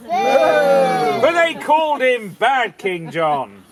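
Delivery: slow, loud shouted proclamation; booming shout. Acted. Storyteller to children, slow booming shout. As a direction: call it out slowly to the crowd.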